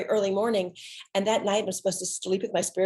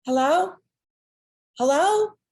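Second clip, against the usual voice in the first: calling into silence; 10 semitones higher, 5 dB louder than usual. Retold or imitated. Silence on the phone line.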